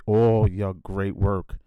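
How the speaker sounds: normal talking voice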